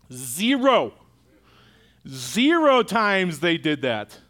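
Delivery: slow, heavy emphasis on zero; emphatic zero. Real, not acted. Preacher to congregation, slow emphatic zero. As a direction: let the zero land slowly. Answer to how many times.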